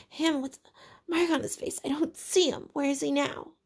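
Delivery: put-on high, breathless, frightened boy; struggling for breath in fear; gasping Piggy. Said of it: "he was gasping for breath".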